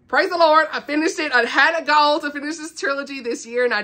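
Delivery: high, loud burst of celebration; real jubilation. Real, not acted. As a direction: celebrate a goal met, voice lifted high. Celebrates finishing the trilogy.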